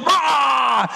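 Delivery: hammed-up high shout, mocking; mock roar. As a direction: bark a mock battle cry. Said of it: "ungodly is going".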